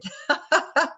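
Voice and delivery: real high laugh. Real, not acted. Can only see top of head.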